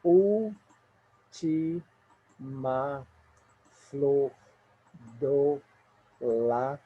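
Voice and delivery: each syllable set apart with long pauses; counting syllables. Shown as a demonstration. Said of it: "conte aqui comigo".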